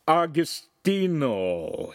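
put-on long, high shout in heavy accent. A narrator's reading. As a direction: call the name out, drawn out. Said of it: "he shouted in a heavy Cambrian accent".

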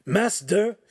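high urgent call; calling, out of breath. A narrator's reading. Ugolino bursting in at dinner, breathlessly calling the master. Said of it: "Ugolino called, out of breath".

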